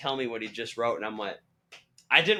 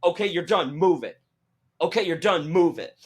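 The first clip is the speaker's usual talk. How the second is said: loud, higher repeated mock orders; strict; 6 semitones higher, 5 dB louder than usual. Staff hurrying fans through, in a strict mock bark. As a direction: herd them along with firm falling commands. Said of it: "like, strict".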